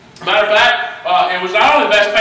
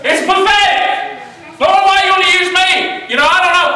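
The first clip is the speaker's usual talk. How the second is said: full-force preaching; 9 semitones higher than usual. Real, not acted. Preacher rebuking the congregation's excuses, rising shout. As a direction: drive it home to the church like a fire sermon.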